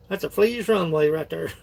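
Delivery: usual speaking voice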